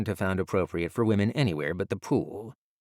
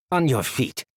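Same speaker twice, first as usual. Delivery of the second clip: louder guard command; barked order; 5 semitones higher, 6 dB louder than usual. A narrator's reading. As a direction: order the prisoners up.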